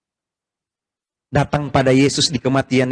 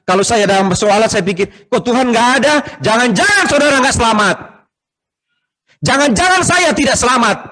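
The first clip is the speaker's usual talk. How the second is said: higher, louder preaching climax; sermon rising to a shout; 10 semitones higher, 6 dB louder than usual. Acted. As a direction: build to a full-voiced pulpit cry.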